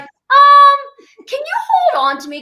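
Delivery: loud, high-pitched nervous plea; hammed-up panic, voice shooting up; mock alarm. Guest acts out asking for help while hanging upside down, mock alarm.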